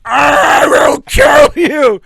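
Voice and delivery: very slow, loud, high bellow; Farley in the sketch, bellowing a furious threat; furious roar. Retold or imitated. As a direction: drag out the threat at full volume. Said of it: "He's like".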